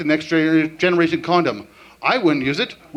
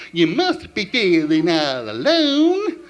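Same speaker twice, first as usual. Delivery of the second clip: very slow cartoon moose sing-speak; much slower than usual. Drunk karaoke, very slow cartoon moose voice. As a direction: drag each word out like a dopey moose. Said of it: "let's try this one".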